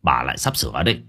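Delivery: husband hushing wife's talk; brushing it off. A narrator's reading. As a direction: wave her off quickly. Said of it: "vội xua tay".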